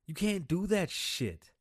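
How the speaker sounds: heated, deliberate rebuke; angry scold. Real, not acted. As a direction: slam each word down.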